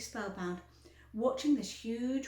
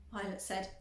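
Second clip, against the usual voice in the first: plain reading voice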